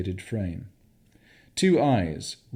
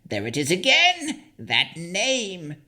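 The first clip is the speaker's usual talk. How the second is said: frantic hag; 10 semitones higher than usual. Acted. The hag hitting her own head, high frantic crone cry. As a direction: cry it out like a crazed old hag. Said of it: "hitting her own head".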